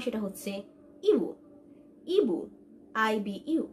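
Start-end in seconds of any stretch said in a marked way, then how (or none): 1.1-2.4 ইবু as 'ee-boo', said on its own; model pronunciation. Shown as a demonstration. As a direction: say ইবু carefully with a clear 'ee'. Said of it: "আই দিয়ে মালয় ল্যাঙ্গুয়েজে use হয়". (স্পষ্ট ই দিয়ে ইবু)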